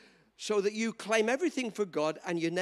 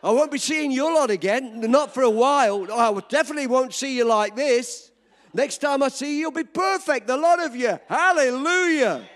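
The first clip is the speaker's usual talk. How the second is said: loud, rousing preacher's call; laughing, loud pulpit fervour; full-force preaching; 3 semitones higher, 7 dB louder than usual. Acted. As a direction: preach it out to the whole church.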